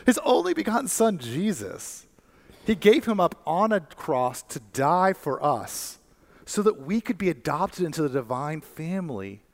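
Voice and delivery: high, ringing preacher's climax; preaching at full force. Acted. Preacher tells his congregation God brags about them, high and ringing. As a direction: lift the room, proclaim it.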